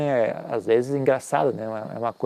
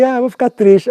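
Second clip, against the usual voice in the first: mock sulky whine; 10 semitones higher, 7 dB louder than usual. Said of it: "aí eu vi".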